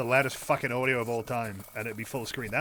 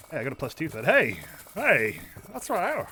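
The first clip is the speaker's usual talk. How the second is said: normal talking voice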